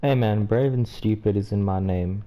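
ordinary talk, nothing marked

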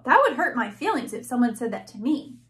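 ordinary delivery, nothing marked

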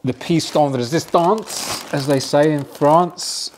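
hammed-up French accent, high and slow; playful fake French flourish; mock French. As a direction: say it like a pompous Frenchman, slowly. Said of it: "I don't know why I just spoke French then".

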